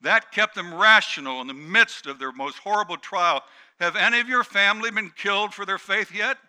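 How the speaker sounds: high, loud, impassioned preaching; preaching at full force. Real, not acted. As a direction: thunder it at the congregation.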